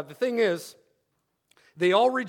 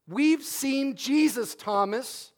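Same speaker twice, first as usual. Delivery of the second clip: very slow, stretched insistence; slow drawn-out nagging; 4 semitones higher, much slower than usual. Retold or imitated. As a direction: drag each word out to needle him. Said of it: "you could imagine what this is like for a week".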